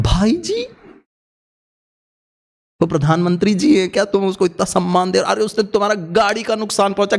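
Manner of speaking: high, fast indignant outburst; outraged disbelief. Retold or imitated. Friend is outraged that he respects the driver who hit them. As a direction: explode in disbelief at the politeness.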